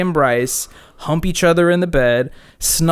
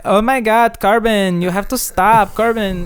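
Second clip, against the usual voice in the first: put-on higher, breathy-free Israeli-accented girl voice; Gal Gadot accent; 8 semitones higher than usual. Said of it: "she speaks like Gal Gadot".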